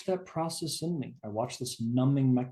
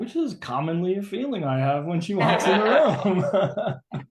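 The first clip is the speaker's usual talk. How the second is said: laughing through the words; amused laugh mid-sentence; 8 semitones higher, 10 dB louder than usual. Real, not acted. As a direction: chuckle while you say it. Laughs at own joke.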